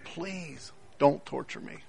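hushed, slow imitated plea; begging. Preacher voices chained man pleading with Jesus, quiet begging. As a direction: beg softly, fading almost to nothing. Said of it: "he's begging that Jesus doesn't hurt him".

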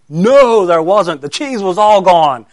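loud, high burst on "No", then easing in pitch; emphatic exasperation. Real, not acted. Preacher tells children the traps failed again, emphatic exasperation. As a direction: exclaim it with theatrical frustration. Answers own question, exasperated storytelling.

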